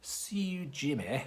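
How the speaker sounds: high, challenging Glasgow hard man; a mock threat in broad Scottish; Scottish accent. Warning viewers about a Glasgow street threat, Scottish accent. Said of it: "if anyone says that to you".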